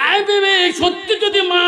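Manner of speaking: high, loud chanted appeal; acted calling out. Husband swears to his wife, high loud appeal.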